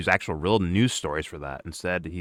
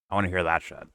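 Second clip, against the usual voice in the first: normal talking voice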